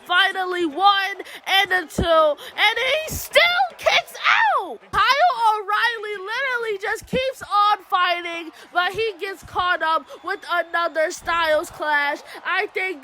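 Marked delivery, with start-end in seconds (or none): 2.0-8.5 stunned. Real, not acted. Disbelief at a late kickout.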